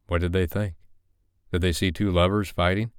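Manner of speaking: ordinary delivery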